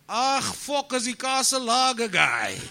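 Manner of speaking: put-on high, loud Afrikaans-accented cop voice. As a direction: play the cop recognising you, thick Afrikaans accent. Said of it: "and he says".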